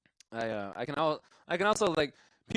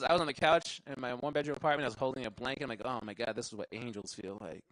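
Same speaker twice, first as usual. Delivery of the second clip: ordinary talk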